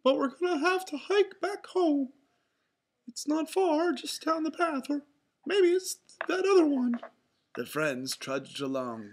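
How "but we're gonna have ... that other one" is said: high, loud falsetto mouse; Mickey Mouse. A narrator's reading. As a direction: talk on in a high cartoon voice. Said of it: "said Mickey".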